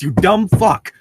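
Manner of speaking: ordinary delivery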